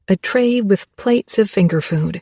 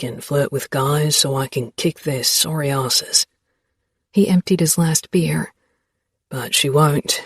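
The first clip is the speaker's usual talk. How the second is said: lightly lowered male voice; a hint of a teasing young man; Xavier voice; 6 semitones lower, 3 dB quieter than usual. A narrator's reading.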